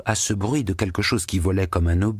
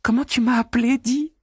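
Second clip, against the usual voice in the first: teasing shout. A narrator's reading. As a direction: yell into the pig's ear. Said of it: "criait-elle".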